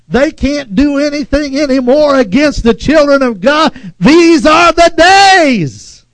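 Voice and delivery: rally the congregation; preacher building to a loud climax; full-force preaching. Acted.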